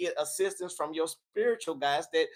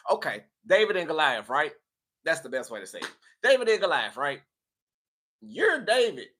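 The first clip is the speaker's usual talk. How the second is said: ordinary talk, nothing marked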